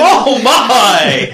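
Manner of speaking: high, loud laughing exclamation; laughing shout. Real, not acted. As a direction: burst out laughing in shock. Laughing outburst at co-host.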